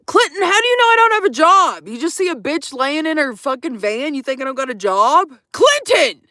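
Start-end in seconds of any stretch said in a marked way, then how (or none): 0.0-1.9 louder, higher mock outrage at Clinton; mock indignant. Real, not acted. Streamer scolding viewer Clinton about having a job, mock indignant. 5.5-6.2 much higher, louder shout of Clinton; mock scolding shout. Real, not acted. Streamer calling out viewer Clinton, mock scolding shout.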